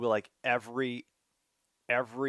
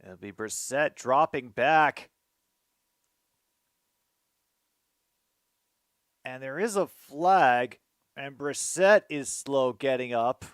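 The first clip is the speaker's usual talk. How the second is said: live play-by-play; 3 semitones higher, 8 dB louder than usual. Acted. Fan calling the Patriots drive to viewers, excited play-by-play. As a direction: call the drive like a broadcaster.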